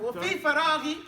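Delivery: loud, careful model of فراغي with a strong غ; model pronunciation. Shown as a demonstration. Teacher models the phrase loudly so students repeat it. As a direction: say وفي فراغي for the class to copy. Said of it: "teacher models phrase for repetition".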